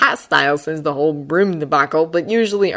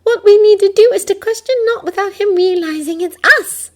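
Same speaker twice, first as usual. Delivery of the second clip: put-on high girl voice, reasoning; Hermione voice; 7 dB louder than usual. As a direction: lay out the logic.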